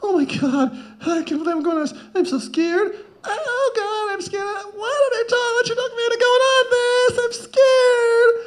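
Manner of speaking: high, squealing mock terror on a ride; scared rider. Rider on the left panicking before the drop, squealing in mock fear. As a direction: whimper like a terrified first-timer on a coaster. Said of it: "the person on the left was like".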